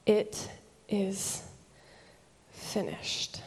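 halting, sinking to a whisper; spent, fading last breath; dying words. Acted. Preacher voices Jesus's dying words.

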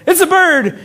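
mock excited bystander. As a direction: point at the sky in mock awe. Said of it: "another man that says".

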